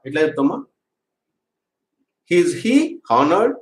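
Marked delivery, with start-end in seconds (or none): 2.4-3.6 each word drawn out, rising at the end; slow dictation. Shown as a demonstration. Teacher dictating a question to students, very slowly. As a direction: spell out "Is he honored?" word by word so students can write. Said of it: "teacher repeats question".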